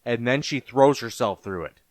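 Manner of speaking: plain reading voice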